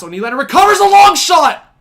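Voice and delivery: sudden loud high play-by-play call; excited shout. Real, not acted. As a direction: yell the save call out. Live hockey play-by-play excitement.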